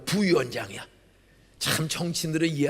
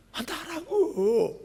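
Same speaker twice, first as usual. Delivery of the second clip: put-on slow, higher crying drunk voice, lightly done; drunk crying brother; 11 semitones higher, much slower than usual. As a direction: slur it through tears like a drunk brother. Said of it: "술 취해서 울어". (술 취해 우는 형 흉내)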